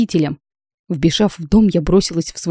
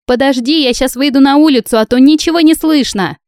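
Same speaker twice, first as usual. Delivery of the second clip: higher and louder, calling over music; shouting; 8 semitones higher, 6 dB louder than usual. A narrator's reading. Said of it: "прокричала Светка".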